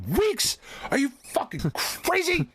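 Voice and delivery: very high, incredulous yelling; retold hysterical indignation, voice shooting up; outraged outburst. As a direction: screech in disbelief at the doctor. Retold outraged reply to doctor.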